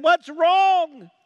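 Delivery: high, loud urgent question; drawn-out alarmed yell; acted alarmed shout. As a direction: call out like a worried dad running over. Alarmed dad runs out to son.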